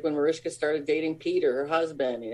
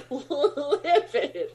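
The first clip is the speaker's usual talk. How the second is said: laughing; 10 semitones higher than usual. Real, not acted. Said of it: "like, livid".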